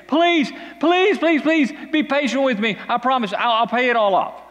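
high, pleading servant voice, please after please; begging servant. Acted. Servant begging his master for patience, high and pleading. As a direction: beg the master for mercy.

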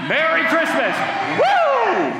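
high, loud festive cheer; joyful shout. Real, not acted. Pastor cheering Christmas to the congregation, loud and high. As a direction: whoop it out to the whole room.

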